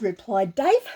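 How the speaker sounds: usual speaking voice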